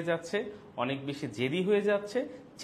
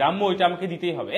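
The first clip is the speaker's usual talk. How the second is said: put-on demanding child; 4 semitones higher, 9 dB louder, faster than usual.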